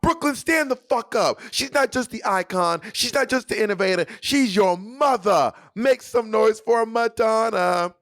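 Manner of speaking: high, slow announcer shout to the crowd; stage shout at Barclays; arena hype shout. Retold or imitated. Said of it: "videos of me going".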